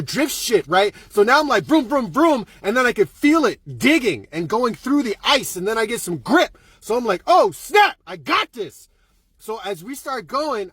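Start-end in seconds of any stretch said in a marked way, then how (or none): 1.6-2.5 raised, quick mouth-made revs; engine revving imitation. Driver doing Tokyo Drift moves, imitated engine revving. 7.3-8.9 high, loud burst of excitement; acted sudden delight. Driver finally getting some grip, mock-excited outburst.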